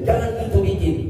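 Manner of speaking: Batak-style telling-off; Batak way of speaking. Shown as a demonstration. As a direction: say jangan gitu bikin the blunt Batak way. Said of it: "Coba saya roba dulu nadanya".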